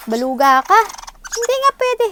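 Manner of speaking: loud, high exasperated telling-off; angry scold. Real, not acted. Scolding her child, louder.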